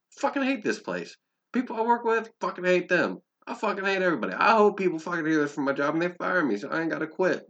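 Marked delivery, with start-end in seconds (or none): 0.1-5.7 fed-up rant. Real, not acted. Ranting about hating his job.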